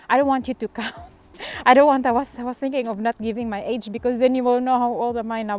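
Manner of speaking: flustered laughing. Real, not acted. Flustered about revealing her age.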